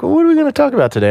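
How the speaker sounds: imitating Doctor Carroll. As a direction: rattle it off high and quick. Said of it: "he's like".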